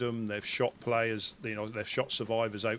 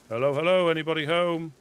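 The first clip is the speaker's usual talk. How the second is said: loud, high call into an empty house; 6 semitones higher, 8 dB louder than usual. Acted. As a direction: call out loudly to anyone inside. Calling out into a building.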